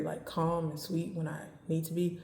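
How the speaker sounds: usual speaking voice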